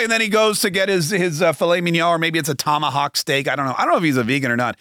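normal talking voice

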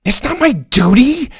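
put-on a kid snapping back defensively; shooting back. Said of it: "Ben shot back".

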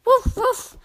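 high playful puppy woofs; puppy barking. Pretend. Narrator voicing Biscuit the puppy for kids, high woofs. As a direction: bark like a happy little puppy.

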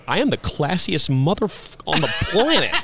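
hammed-up classy. Boasting about himself in a suit.

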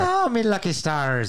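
high, slow leprechaun Irish; hammed-up Irish exclamation, drawn out; mock Irish accent.

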